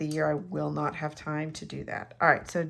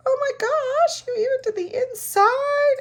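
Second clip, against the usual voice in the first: high-pitched, loud gasping amazement; amazed reaction; 7 dB louder than usual. Retold or imitated. Card recipient discovers the decorated inside, mock-amazed. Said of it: "that's totally what they say".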